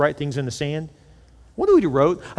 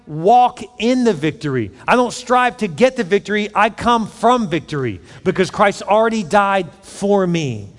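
louder, higher, slow declaiming sermon voice; full-force preaching; 6 semitones higher, 4 dB louder, slower than usual. Acted. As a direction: proclaim victory to the congregation. Preaching to congregation.

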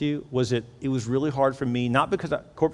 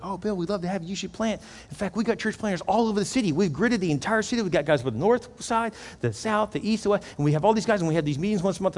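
higher-pitched, welcoming pastor rattling off plans; eager pastor; 7 semitones higher than usual. Retold or imitated. Pastor boasting to Bill about church planters, eagerly. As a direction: imitate an upbeat pastor selling his network.

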